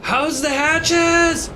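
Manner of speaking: high, loud, slow call over the wind; yelling over a storm. Acted. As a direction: bellow it across the deck through the storm. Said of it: "You hear Marlin yell over the storm".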